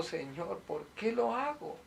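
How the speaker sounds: soft, high-rising plaintive question; mild mock-weeping plea to God; retold tearful plea. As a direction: imitate praying through tears, quietly. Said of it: "me pongo a llorar y le digo".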